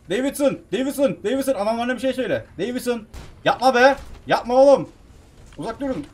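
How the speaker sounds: high, loud pleading yells; alarmed, voice pushed up and loud; panicked shouting. Real, not acted. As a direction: call out urgently to stop the attackers. Shouting at Davidson to call off men.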